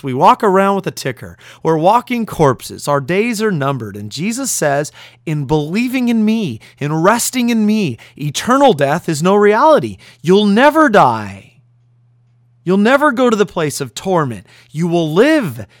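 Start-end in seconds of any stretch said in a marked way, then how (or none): 5.2-15.7 full-force preaching. Acted. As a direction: drive the promise home to the listeners.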